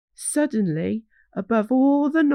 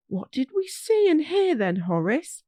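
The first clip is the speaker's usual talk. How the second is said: put-on children asking; 7 semitones higher than usual. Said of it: "all the children asked together".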